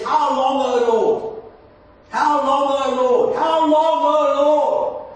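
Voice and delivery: high, loud cry out to God, building with each repeat; anguished cry. Acted. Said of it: "this cry of the human heart out to God".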